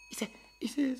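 ordinary talk, nothing marked

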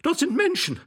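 very high, wailing female voice; high, frantic lady's cries; hysterical lady. A narrator's reading. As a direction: lament in horror, pitched right up.

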